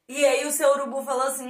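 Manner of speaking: ordinary delivery, nothing marked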